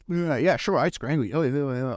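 retold mocking agreement; antagonistic sarcasm. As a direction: throw back a dismissive, sarcastic yes. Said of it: "trying to be antagonistic back".

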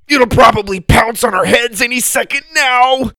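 very high, loud, sing-song wail; panicked wail. Acted. Sawyer wailing to Susie as they flee, high panicked wail. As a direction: wail the doom while running. Fleeing kangaroo.

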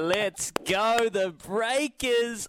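high, slow drawn-out cheer; excited cheer. Real, not acted. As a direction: chant it like a fan in the stands. Host joins live hype.

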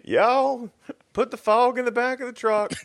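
high-pitched, hammed-up West Virginia yokel; West Virginia accent. Yokel orders the fog into the truck, mock West Virginia accent. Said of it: "I can't even do a West Virginia accent".

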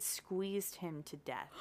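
slow, grave storyteller voice; slow ominous reading. A narrator's reading. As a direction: read it slowly for suspense. Reading a grim mystery quote.

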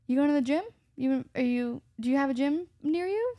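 high, mock-innocent questioning; pointed hinting. Voices a relative hinting about weight.

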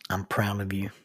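low, hushed and sincere; tender encouragement. Acted. The voice everyone needs in their life, tender encouragement. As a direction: softly tell them, letting it sink in. Encouraging voice parent gives a child.

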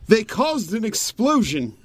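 high, slow, whiny complaint; whiny character voice. Acted. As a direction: whine it out in a high voice. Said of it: "I'm gonna do the whole scene here".

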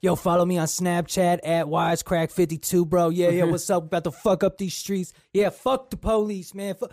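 high, loud, hyped-up rioter voice; hyped street bro. Retold or imitated. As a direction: shout it like an amped-up kid on camera. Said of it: "a lot of them were just like".

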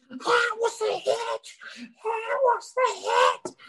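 very high, slow, dragged-out mimicry; toothless friend imitation. Friend who just lost his teeth, high slow mimicry. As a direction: imitate a dazed man minus front teeth. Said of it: "And he's like".

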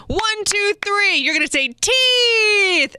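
high, slow, swooping count to the word teeth; sing-song photographer. Retold or imitated. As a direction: stretch it out like a showy photographer. Photographer coaxing the child.

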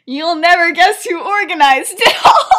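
high, loud, laughing delivery; laughing reveal. Retold or imitated. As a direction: tease out the gossip through laughter. Teller breaks up over the reveal.

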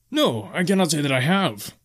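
high, lilting barkeep; high-pitched tavern keeper, swooping on the no; bartender's denial. A narrator's reading. Bartender answers the traveler's question.

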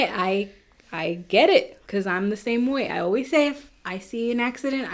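usual speaking voice

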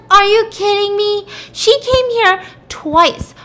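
high, loud acted disbelief; incredulous outrage. Woman contradicting the husband, high incredulous outrage. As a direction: pitch it way up, indignant and drawn out at the end.

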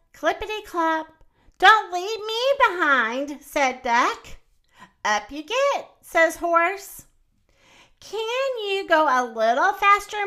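animated, sing-song picture-book narration; lively children's storytime voice; story read to children. A narrator's reading. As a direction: read it playfully to little listeners, drawing out key words.

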